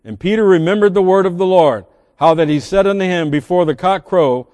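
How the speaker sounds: steady Bible reading; scripture reading. Acted. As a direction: read the story of Peter aloud. Reads Luke 22 aloud.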